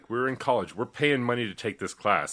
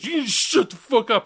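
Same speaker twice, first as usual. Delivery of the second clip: much higher, louder retold snarl; mock angry snap; 11 semitones higher, 4 dB louder than usual. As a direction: play the classmate snapping back. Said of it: "He goes".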